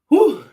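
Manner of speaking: high, loud puff of breath; winded whew. Real, not acted. Streamer winded from push-ups, blowing out a high whew. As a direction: let out a sharp, winded whew. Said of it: "I'm out of breath".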